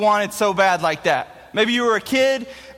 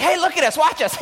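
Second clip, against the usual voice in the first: higher, eager calling out; excited kids; 8 semitones higher than usual. Retold or imitated. Kids calling to their parents to watch them ride. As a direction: call to your parents to watch.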